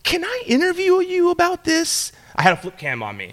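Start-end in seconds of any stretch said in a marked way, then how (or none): 0.0-2.1 high-pitched, slightly careful ask; polite request. Retold or imitated. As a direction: re-enact a hopeful interview request. Politely asking strangers for an interview.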